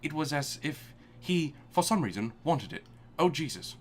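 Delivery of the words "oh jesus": ordinary talk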